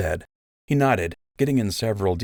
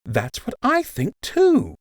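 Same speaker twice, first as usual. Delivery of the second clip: higher mock-earnest agreement, jumping up on too; exaggerated agreement; 4 dB louder, slower than usual. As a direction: overplay agreeing with him. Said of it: "with exaggerated agreement".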